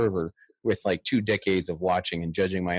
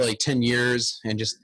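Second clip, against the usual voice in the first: ordinary delivery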